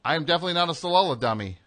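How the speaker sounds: as fast as you can; fast tongue twister. Shown as a demonstration. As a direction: rattle it off fast. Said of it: "Say it as fast as you can".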